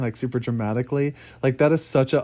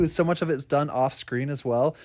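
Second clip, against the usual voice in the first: ordinary talk, nothing marked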